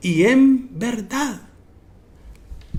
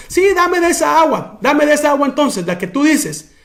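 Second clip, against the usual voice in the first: raised higher, pleading for the water; a woman eagerly begging, higher pitched; eager Samaritan woman; 7 semitones higher than usual. Retold or imitated. Preacher voicing the woman asking Jesus for water, eager and higher.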